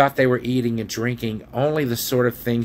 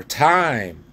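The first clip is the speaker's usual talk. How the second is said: raised, higher call on one long word; louder call; 5 semitones higher, 4 dB louder than usual. A narrator's reading. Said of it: "then louder".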